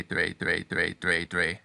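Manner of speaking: "dre" said over and over with a tongue flick; repeated dre. Shown as a demonstration. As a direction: repeat "dre" in quick taps. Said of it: "practice saying dre".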